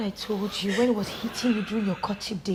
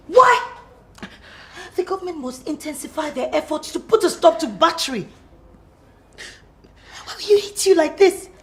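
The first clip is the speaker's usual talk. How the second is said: much louder, higher indignant outcry; outraged; 9 semitones higher, 6 dB louder than usual. Acted. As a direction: shout your outrage at the injustice. Outraged friend hearing of beating.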